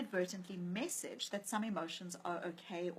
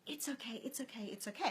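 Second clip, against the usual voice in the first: put-on soft, hushed reassurance to a crying baby; soothing; 2 semitones higher, 7 dB quieter than usual. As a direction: murmur comfort to a hurt infant. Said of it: "picked him up and said".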